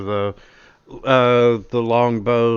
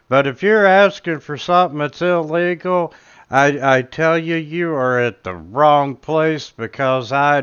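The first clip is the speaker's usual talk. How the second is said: loud, higher-pitched shopkeeper's disclaimer; indignant merchant; 5 semitones higher, 7 dB louder than usual. Acted. Merchant denying he sells anything illegal, loud and emphatic. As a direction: declare it loudly for all to hear. Game master voices the wary merchant.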